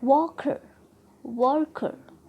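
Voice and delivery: "Worker" with a strong वर; first-syllable stress. Shown as a demonstration. Teacher giving a rule example, first syllable stressed in "worker". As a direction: hit वर, ease off on कर in "worker". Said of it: "हम first syllable पर trust कर रहे हैं".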